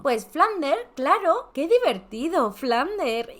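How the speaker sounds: put-on high, loud childish answer; birthday girl naming her fish; little girl voice. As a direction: chirp it like a proud kid. Girl voice again.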